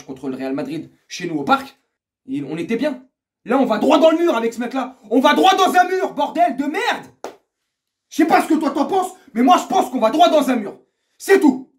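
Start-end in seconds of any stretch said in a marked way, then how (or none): 3.4-5.0 rising anger. Real, not acted. First take, rising anger. 5.1-7.1 very high, loud outburst; furious shout. Real, not acted. Fan warning of disaster under this coach, furious. Repeats it yelling with swear. 8.1-11.7 high, loud, final verdict; angry insistence. Real, not acted. Fan giving viewers his verdict, angry shouting.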